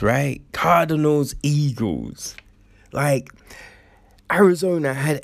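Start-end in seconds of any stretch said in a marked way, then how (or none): none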